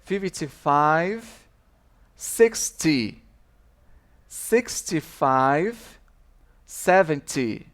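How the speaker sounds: each number said slowly and clearly with a pause; slow number drill. Shown as a demonstration. Teacher modelling tens for Brazilian learners, slow and clear. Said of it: "a gente fala".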